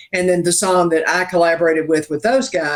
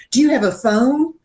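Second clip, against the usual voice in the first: higher-pitched imitation of an earnest question; mock-serious; 8 semitones higher than usual. Imitating a producer questioning an older songwriter, mock-serious. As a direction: imitate someone asking in all seriousness. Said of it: "he was so serious".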